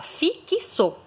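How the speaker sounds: "fixo" as fi-ki-so, three syllables; hidden K revealed. Shown as a demonstration. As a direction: say "fixo" with an added vowel after K. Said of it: "Você fala fixo".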